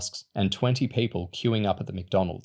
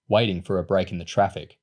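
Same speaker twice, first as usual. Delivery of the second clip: plain reading voice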